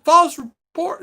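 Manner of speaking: incredulous echo, high and loud; getting angry. Retold or imitated. Driver to policeman accusing him of a false report, getting angry. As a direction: throw the accusation back, pitch leaping up. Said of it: "then I started getting angry".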